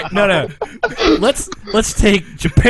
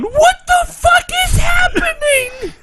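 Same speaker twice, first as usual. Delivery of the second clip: very high, drawn-out shriek; hammed-up bewildered screech; mock screaming disbelief; 4 dB louder, slower than usual. Friend mocking the absurd plot, slow high shriek.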